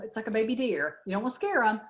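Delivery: ordinary talk, nothing marked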